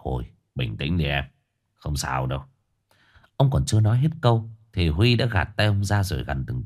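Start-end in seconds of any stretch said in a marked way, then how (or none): 0.0-2.4 soft, low reassurance; calm him down gently; gentle soothing. A narrator's reading. Boss soothing the shaken Huy, tender. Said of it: "ân cần khoác vai Huy".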